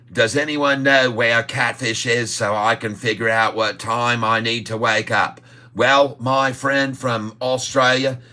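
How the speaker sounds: hammed-up Aussie accent; jokey mock Australian. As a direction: put on a playful, stretched-out Australian accent. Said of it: "Better Aussie accent than the last time".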